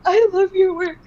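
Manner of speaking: high, tearful declaration; tearful fan at a convention table; teary fan. Retold or imitated. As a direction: blurt it out through tears. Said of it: "like, crying".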